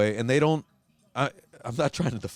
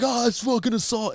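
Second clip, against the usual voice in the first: mock outrage; 12 semitones higher, 3 dB louder than usual. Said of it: "everyone's like".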